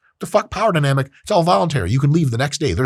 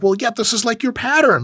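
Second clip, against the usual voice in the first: exasperated partner; 11 semitones higher than usual. Retold or imitated. Acted partner argument.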